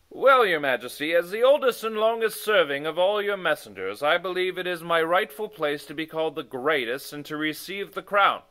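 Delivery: loud, self-assured claim; proud old messenger. Acted. Oniggins tells the king he deserves the crown, proud. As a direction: stake your rightful claim.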